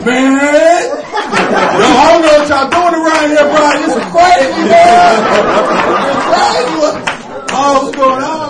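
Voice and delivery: high, loud, slow panicked voice; hammed-up frightened friend yelling; panicked guy. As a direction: freak out like a scared churchgoer. Scared guy panicking at demon.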